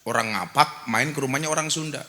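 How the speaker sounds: ordinary delivery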